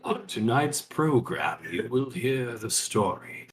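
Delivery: low, slow dramatic radio host; radio announcer. Acted. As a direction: intone it like a spooky radio show. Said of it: "over the radio".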